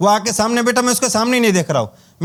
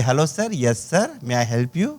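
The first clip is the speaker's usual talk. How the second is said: polite receptionist; 8 semitones lower, 4 dB quieter, much slower than usual. Retold or imitated. Receptionist greets a guest.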